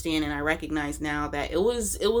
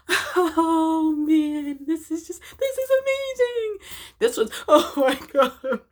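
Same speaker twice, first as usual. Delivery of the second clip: high-pitched hammed-up sobbing; mock weeping that builds to a loud high wail; imitated bawling; 12 semitones higher, 6 dB louder than usual. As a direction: blubber through it, mimicking a total breakdown. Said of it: "Like, I was bawling, breaking down".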